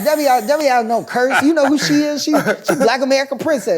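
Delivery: fast, high, loud imitation; mock emphatic disbelief. Comedian dismisses the director idea, imitated fast, high and loud. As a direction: imitate her scoffing in a loud, high rush.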